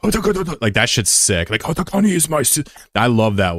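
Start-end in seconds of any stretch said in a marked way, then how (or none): 0.0-0.6 high mock-Japanese anime gibberish; pitched-up anime-style fake Japanese. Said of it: "the Japanese anime thing". 1.6-2.6 high mock-Japanese anime gibberish; pitched-up anime-style fake Japanese. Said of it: "the Japanese anime thing".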